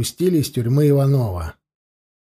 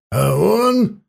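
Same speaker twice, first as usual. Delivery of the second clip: sharp, loud, higher cry; frenzied shout; 6 semitones higher, 4 dB louder than usual. A narrator's reading. Prisoner to interrogator about Petrov: frenzied shout. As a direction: yell it out, losing control. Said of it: "закричал неистово Иванов".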